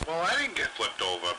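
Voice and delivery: ordinary delivery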